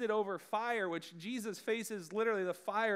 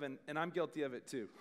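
normal talking voice

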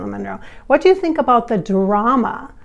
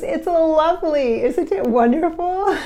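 lightly voiced starlet; 7 semitones higher than usual. Channeled Marilyn delights in fans kissing her grave, higher pitched. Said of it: "And she goes".

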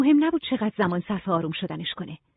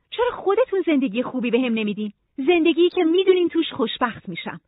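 sudden higher-pitched complaint; demanding Nora; 7 semitones higher than usual. Acted. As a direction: press for an answer, pitch lifting. Voices Nora's sudden demand. (معترض و طلبکار، با صدای زیر)

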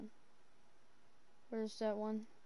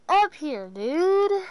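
loud call; 5 semitones higher, 11 dB louder, slower than usual. Real, not acted. Calling out loudly.

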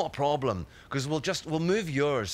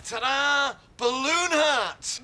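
high, drawn-out fanfare; showy reveal; 8 semitones higher, much slower than usual. Acted. Parent showing a child a party trick, showy. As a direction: unveil it with proud excitement. Shows off balloon hat.

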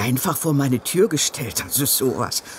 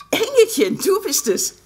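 delighted; 12 semitones higher than usual. Acted. Mother delighted at daughter's call.

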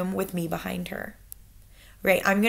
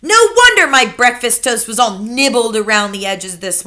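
loud, pressing grandmother; relentless grandmother; 2 semitones higher, 8 dB louder than usual. Acted. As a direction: keep hammering at him. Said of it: "my grandmother went on relentlessly".

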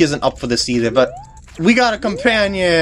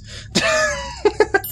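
high impish cackle. Acted. Skull Kid mocks after separating fairies.

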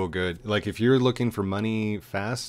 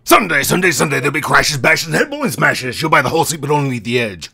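booming monster truck ad voice, much louder and higher; monster truck announcer; 5 semitones higher, 13 dB louder, faster than usual. Retold or imitated. Friend mimics his co-host's voice as a booming monster truck ad. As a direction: hype it like a Sunday Sunday Sunday ad. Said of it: "I wanna try the voice".

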